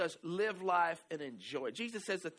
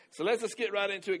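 ordinary delivery

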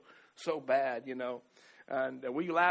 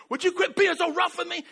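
higher and louder, a sharp complaint; annoyed scolding; 11 semitones higher, 7 dB louder than usual. Shown as a demonstration. Husband imitates the harsh way his wife did not say it. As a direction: scold him to quit it. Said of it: "instead of just".

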